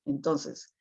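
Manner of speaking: ordinary delivery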